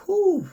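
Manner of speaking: high, loud exhaled whew. Real, not acted. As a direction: blow it out big and high. Relief exclamation after laughing.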